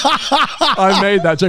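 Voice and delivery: said through laughter, slowly; laughing. Real, not acted. Admitting the joke to his co-host while laughing. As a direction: laugh the words out. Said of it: "I know you did".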